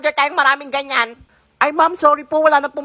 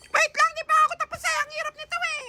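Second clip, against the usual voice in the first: put-on child voice; 13 semitones higher, 4 dB quieter than usual. Student chatting with classmate.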